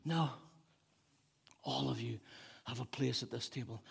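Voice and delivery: hushed, nearly whispered; God's gentle reassurance. Acted. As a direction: softly welcome them in, unhurried. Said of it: "God's saying".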